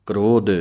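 ਕ੍ਰੋਧ with a firm, breathy dh; heavy dhadda. Shown as a demonstration. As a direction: say ਕ੍ਰੋਧ pressing hard on the dhadda. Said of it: "ਭਾਰਾ ਕਰਕੇ ਬੋਲਣਾ ਹੈ".

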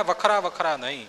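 usual speaking voice

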